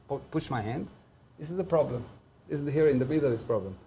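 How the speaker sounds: ordinary talk, nothing marked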